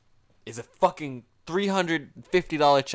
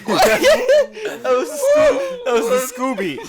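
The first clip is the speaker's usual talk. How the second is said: laughing; much slower than usual. Real, not acted. Laughing hard mid-sentence.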